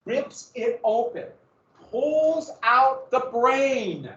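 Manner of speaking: high, very slow, drawn-out telling; dramatic climax. Acted. Teacher telling class how Half King opens the skull, drawn-out. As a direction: stretch each word for gruesome effect.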